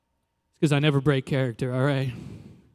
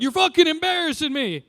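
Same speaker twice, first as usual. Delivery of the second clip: much higher, yelling coach; mock coach yell; 12 semitones higher than usual. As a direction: mimic the coach losing it. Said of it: "my Little League coach's big line".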